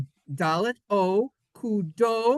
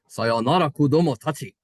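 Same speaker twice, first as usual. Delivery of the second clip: anime-style Japanese; 4 semitones lower, 5 dB louder than usual. Shown as a demonstration. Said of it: "Does it sound like Sailor Moon?".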